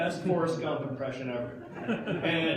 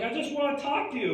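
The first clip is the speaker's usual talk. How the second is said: high, quick, falsely friendly cop; a chasing cop, much higher and quicker; mock cop voice; 10 semitones higher, faster than usual. The cop calling after the fleeing thieves, mock sweet.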